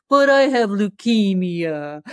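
slow, drawn-out mock plea; son milking it. Son joking to mom for junk food, slow mock plea. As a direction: drag it out like a kid playing the card. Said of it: "he'd be like".

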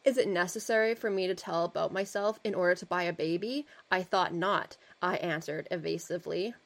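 put-on measured reading of an old article; steady reading-aloud voice; story narration. A narrator's reading. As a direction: read it like a period storyteller.

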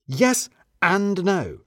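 very slow, drawn-out yes and no; slow hedge. Acted. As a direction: weigh each word carefully. Narrator hedges slowly.

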